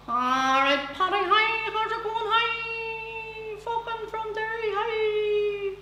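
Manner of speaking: put-on high sing-song Derry accent; squeaky Bogside Derry accent. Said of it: "close your eyes ... from Beijing".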